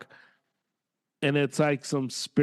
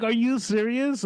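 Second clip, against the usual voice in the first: incredulous; 11 semitones higher than usual. Retold or imitated. Reacting to ridiculous posts.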